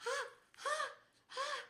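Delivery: short, high cries at icy cold; cold-shock yelps. Real, not acted. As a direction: yelp in little high bursts. Second icy sock going on.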